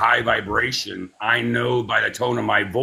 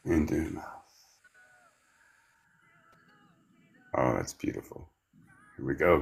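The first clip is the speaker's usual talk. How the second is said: guided breathing; 5 semitones lower than usual. Acted. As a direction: ease them through each breath, gently. Leads a guided breathing exercise.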